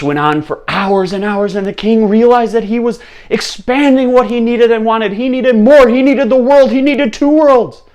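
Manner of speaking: building to high, loud climax; frenzied narration. A narrator's reading. Storyteller showing the king's runaway greed, frenzied. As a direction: escalate each line until nearly shouting.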